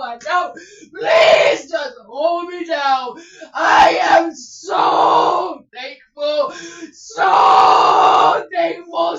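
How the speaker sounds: loud tearful shouted prayer; crying shout. Real, not acted. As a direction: shout it through tears.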